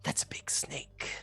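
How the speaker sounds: hushed whisper to himself; awed hunter whispering at a big snake; whispered thought. Acted. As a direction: breathe it out like a secret.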